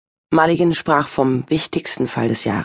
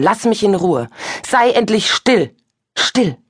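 louder and higher, slow forceful cry; shouting; 6 semitones higher, 3 dB louder, slower than usual. Acted. Julia screams at the voice inside her, shouting.

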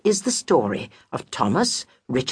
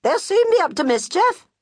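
much higher, louder busybody friend, reassuring then nosy; high fussy friend; 3 dB louder than usual. Acted. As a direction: coo at the widow, then pry. Friend voice again.

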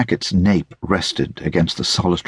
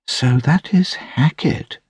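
high, slow lady's voice; slow, high-pitched realisation; attentive lady; 9 semitones higher than usual. A narrator's reading. Lady looking attentively at Hackett, slowly taking him in.

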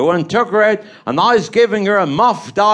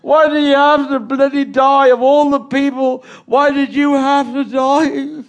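mock graveside shouting; 5 semitones higher than usual. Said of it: "And I'm shouting".

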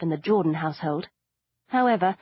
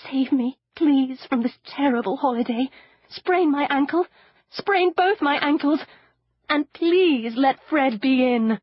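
higher, louder pleading, slowing at the end; teen pleading to God to save her; desperate prayer; 6 semitones higher, 3 dB louder than usual. Acted. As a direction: beg God with mock desperation. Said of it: "she prayed".

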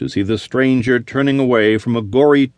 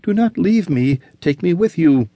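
higher, desperate woman's voice; pleading young woman; 7 semitones higher than usual. Acted. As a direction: beg him to take you along. Olivia pleading.

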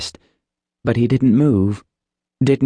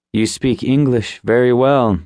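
ordinary delivery, nothing marked

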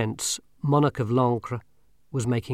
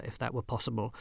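ordinary delivery, nothing marked

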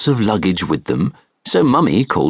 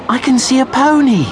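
high, louder delighted voice; excited child; 4 dB louder than usual. A narrator's reading. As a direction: point it out eagerly like a small girl.

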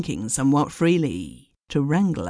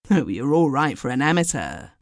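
plain reading voice

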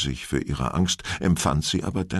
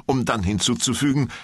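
usual speaking voice

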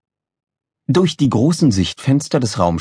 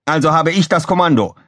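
high, indignant bark; Gizmo barking; 8 semitones higher, 4 dB louder than usual. A narrator's reading. Engineer claims command over rival, barking. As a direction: bark out your rank, pitched up. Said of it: "blaffte Gizmo".

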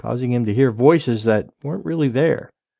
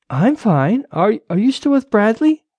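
higher-pitched wife on the phone; Rachel, anxious wife; 7 semitones higher than usual. A narrator's reading. Wife answering her husband's phone call, concerned.

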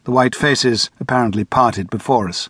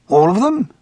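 the chairman; 8 semitones higher than usual. A narrator's reading. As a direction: probe gently but urgently. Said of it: "the chairman asked".

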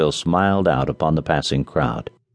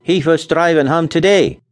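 put-on Scandinavian accent; 9 semitones higher, 4 dB louder than usual. A narrator's reading. Nels voiced, accent.

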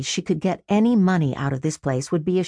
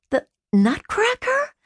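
a much higher rising question of disbelief; incredulous. Acted. Sister hearing the enforcer's name, rising incredulous. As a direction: repeat the name like it's absurd.

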